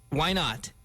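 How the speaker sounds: normal talking voice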